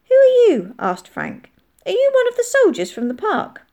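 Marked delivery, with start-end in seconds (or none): 0.1-0.8 put-on high, loud child's question; child demanding answers. A narrator's reading. Child questions stranger in the barn. 1.9-3.6 put-on high, eager child's voice; child questioning. A narrator's reading.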